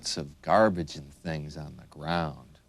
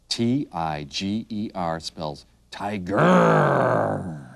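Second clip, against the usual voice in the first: tiger growl; slower than usual. Shown as a demonstration. Teacher spelling tiger for children, growling the word. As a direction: roll "tiger" out into a long growl.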